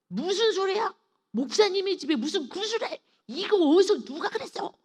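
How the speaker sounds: high, loud indignant shout; angry outburst. Retold or imitated. Pastor angrily rebukes the shaman in her dream, shouting. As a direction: snap back furious at the absurd claim. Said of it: "제가 화를 확 내고".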